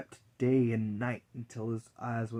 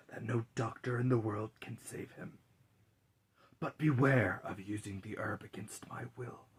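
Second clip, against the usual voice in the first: whispering Death; 9 dB quieter than usual. Acted. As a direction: breathe the words like Death in the dark. Death speaks to godson.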